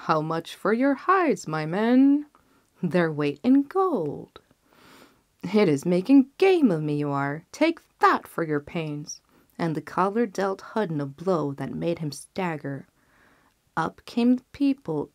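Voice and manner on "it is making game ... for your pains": ordinary delivery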